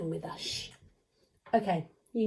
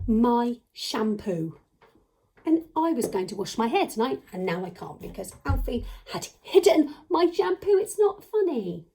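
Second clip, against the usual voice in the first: mock indignation; 4 dB louder than usual. Teacher to puppet who hid her shampoo, mock indignation.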